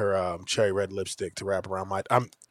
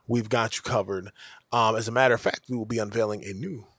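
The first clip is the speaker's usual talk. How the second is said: normal talking voice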